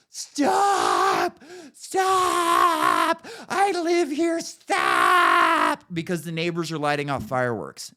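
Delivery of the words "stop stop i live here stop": shrill panicked screaming, pitch leaping; mock scream. Mimicking a woman screaming at neighbours' fireworks. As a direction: imitate a panicked woman yelling stop in the street. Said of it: "this woman screaming in the middle of the street".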